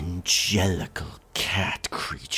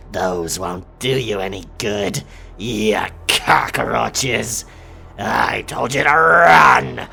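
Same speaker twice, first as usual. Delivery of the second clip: louder and higher with each line, snarling; loud taunting threat; 3 semitones higher, 10 dB louder than usual. Acted. Werecat captain mocks the fleeing pirates, building loud. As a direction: taunt the cockroaches, building to a roar.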